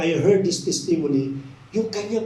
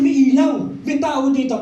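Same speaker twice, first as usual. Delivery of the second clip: put-on higher, louder retelling of a boy's realization; excited discovery; 6 semitones higher, 5 dB louder than usual. Boy sees a light at the house, excited. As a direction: perk up at a sign of someone home.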